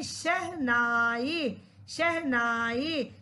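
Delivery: शहनाई drawn out very slowly, syllable by syllable; careful pronunciation. Shown as a demonstration. Teacher has children pronounce it properly, शहनाई slow and careful. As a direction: model शहनाई slowly, with long, low-held vowels. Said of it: "दोबारा pronounce कीजिए अच्छे से".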